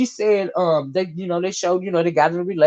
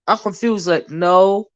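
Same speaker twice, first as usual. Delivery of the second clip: ordinary talk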